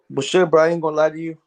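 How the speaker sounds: ordinary talk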